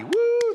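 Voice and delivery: high, loud "woo"; happy whoop. Retold or imitated. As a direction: whoop like a delighted congregation. Imitating the happy congregation cheering.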